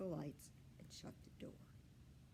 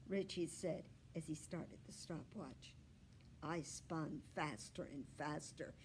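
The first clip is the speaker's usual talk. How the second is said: usual speaking voice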